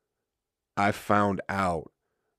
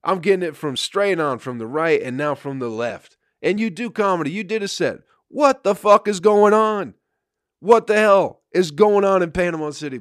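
higher, louder fed-up complaining rant; building into a pitched-up "what the fuck" tirade; exasperated rant; 8 semitones higher, 5 dB louder than usual. Retold or imitated. Comic on stage venting at chatty crowd, exasperated and loud. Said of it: "and it's like".

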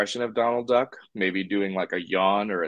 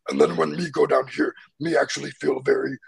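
lightly imitated Cookie Monster; 6 semitones higher than usual. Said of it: "goes into the Cookie Monster voice".

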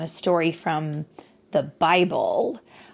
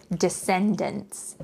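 "descendants" said slowly and carefully; big word slowly; 2 semitones higher, slower than usual. Shown as a demonstration. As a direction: spell out "descendants" for a child. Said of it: "there is a very big word".

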